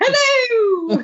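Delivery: very high, squeaky creature greeting; Dobby. Acted. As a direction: squeal it out in a tiny falsetto. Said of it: "It's like Dobby almost".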